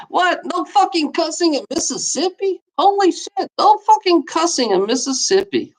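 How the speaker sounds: can't believe what you're reading; a real shocked high voice, half laughing at the law; high incredulous disbelief. Real, not acted.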